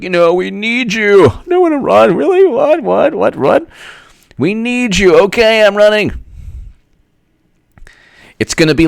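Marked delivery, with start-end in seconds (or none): 0.0-6.1 mock Democrats and Hillary. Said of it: "the Democrats are like".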